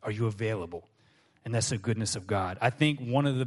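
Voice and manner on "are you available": low, soft and reassuring; God gently inviting the believer back; gentle God voice. Retold or imitated. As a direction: speak as a kind father forgiving. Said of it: "He goes".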